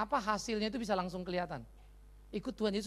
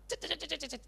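string of quick, high "cet" noises; rapid chain-reaction clicks; 7 semitones higher than usual. Retold or imitated. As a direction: imitate sparks jumping one to the next. Imitates chain reaction spreading.

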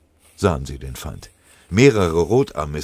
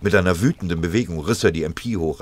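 plain reading voice

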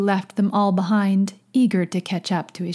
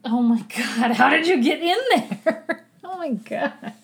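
high, incredulous laughing exclamation; gasp-laugh at a pet's mischief; real exasperated astonishment; amused disbelief; 7 semitones higher than usual. Real, not acted. Finds pet somewhere odd.

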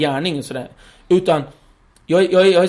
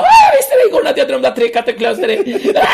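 higher, louder mother shouting her dream; 9 semitones higher, 7 dB louder than usual. Retold or imitated. Mother to son about her dream, higher and louder. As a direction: cry out the omen like a frantic mother. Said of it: "Hon bara".

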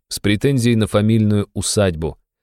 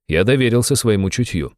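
ordinary delivery, nothing marked

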